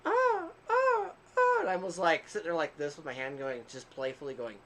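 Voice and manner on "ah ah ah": high-pitched mock moans; imitating moaning. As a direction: mimic her rhythmic moaning, pitched way up. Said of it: "Like, I hear".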